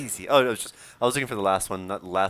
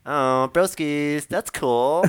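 put-on high, slow, drawn-out valley girl; 4 semitones higher, 6 dB louder, much slower than usual. Imitating his sister pretending, valley girl voice. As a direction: put on a ditzy valley girl, stretching the words. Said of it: "she's like a Valley".

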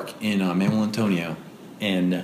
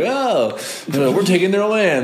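higher, hammed-up Spanish-accented conquistador; mock conquistador; 8 semitones higher than usual. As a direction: swagger it as a smug invader. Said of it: "I like the conquistadors, but accent".

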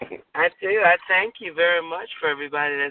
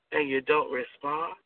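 ordinary delivery

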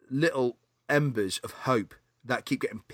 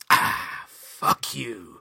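whispered, breathy curse; whispered retort; 2 semitones lower, 3 dB louder, much slower than usual. Retold or imitated. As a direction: hiss it under the breath. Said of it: "drinks the drink ... and goes".

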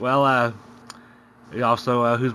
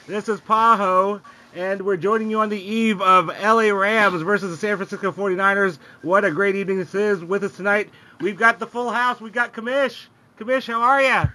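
upbeat host voice, pitched up and bright; podcast host intro; 8 semitones higher than usual. Acted. Host welcoming listeners to the fantasy football podcast, upbeat announcer read. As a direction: open the show like a radio announcer.

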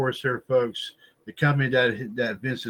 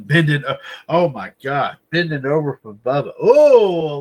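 mock excitement; 3 semitones higher, 8 dB louder than usual. Mocking Vince's prison fate.

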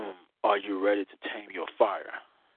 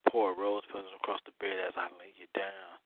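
right in your ear; 6 semitones lower, 7 dB quieter than usual. Acted. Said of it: "as I whisper in your ear".

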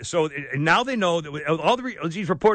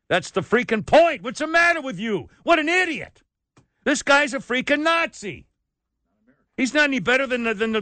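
angry rant; 7 semitones higher than usual. Real, not acted. Ranting at the Pope.

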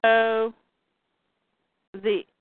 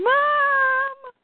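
very high, drawn-out scream; child screaming; 3 dB louder than usual. Retold or imitated. Voicing Jonathan as his mother is hurt, child screaming. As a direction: cry out for your mother, holding the note. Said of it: "Jonathan is screaming".

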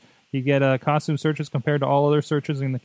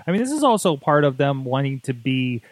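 normal talking voice

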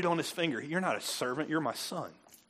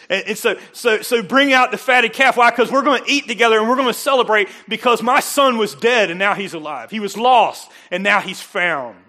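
loud, higher preaching voice, the last line slowed; full-force preaching; 7 semitones higher, 12 dB louder than usual. Acted. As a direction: preach the joy of the homecoming at full volume. Preaching the father's celebration.

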